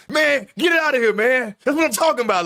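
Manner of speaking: high, loud hype shout; hyping himself up. Retold or imitated. Teammate celebrates his block with a loud, high hype shout. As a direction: yell it like you just blocked his dunk. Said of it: "he hyping himself up".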